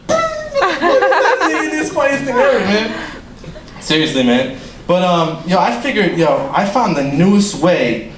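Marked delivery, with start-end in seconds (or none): 0.0-3.2 very high, slow laughing voice; pretend stoned giggling. Comic imagining himself smoking, pretend stoned giggles. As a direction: squeak it through helpless put-on giggles. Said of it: "if I was smoking, I'd be like".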